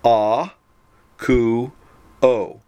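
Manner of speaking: A, ku, o split into three separate beats; syllable by syllable. Shown as a demonstration. Teacher splitting the verb into syllables, each one apart. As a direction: say A, ku, o with a clear break between syllables. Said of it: "broken into its syllables".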